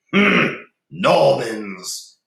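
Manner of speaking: disgusted. A narrator's reading. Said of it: "Cedric muttered to Rowena in disgust".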